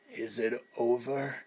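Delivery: dying man's faint question. A narrator's reading. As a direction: barely get the words out. Dying Mickey speaks faintly.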